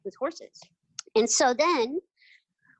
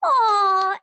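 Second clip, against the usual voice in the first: loud, high, adoring aww; crowd aww; 9 semitones higher, 8 dB louder than usual. Retold or imitated. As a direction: melt like a whole audience. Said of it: "And the crowd goes".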